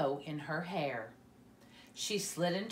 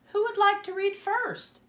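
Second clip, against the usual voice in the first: much higher teacher asking the class; high, louder voice of a cheerful teacher; bright teacher voice; 8 dB louder than usual. Acted.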